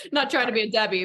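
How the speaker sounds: loud, laughing start; giggling self-conscious aside. Real, not acted. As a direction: laugh through the words. Laughs while answering.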